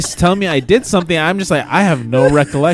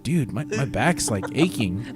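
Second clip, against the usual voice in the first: slow, soft, pained groan of a complaint; complaining of back pain; 4 semitones lower, 9 dB quieter, slower than usual. Retold or imitated. To friends, whining quietly about his aching back. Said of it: "I was just like".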